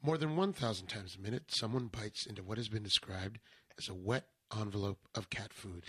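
deadpan NPR narration; NPR style. Acted. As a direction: read it like a serious radio essay. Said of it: "N P R style?".

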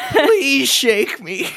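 high, slow pleading can voice, laughing; talking can voice. Pretend. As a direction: beg like the can itself, drawn out.

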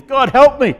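high, loud mock plea; desperate cry for help. Preacher imagines drowning Jonah calling to God, a high loud plea. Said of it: "I can imagine Jonah's drowning".

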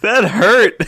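laughing through pain. Real, not acted. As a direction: laugh while saying it. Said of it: "laughing after burp".

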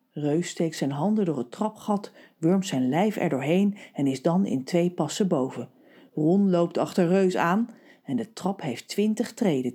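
plain reading voice

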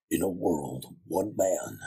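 low, drawn-out trailer announcer; deep movie voice. Shown as a demonstration. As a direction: drop right down into an epic trailer voice. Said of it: "that real deep, um, movie voice".

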